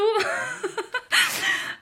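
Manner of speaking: high giggle breaking into the sentence; real giggle. Real, not acted. Woman remembering the Frankfurt supermarket doors, giggling. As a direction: burst out laughing at the memory. Laughs mid-memory of supermarket.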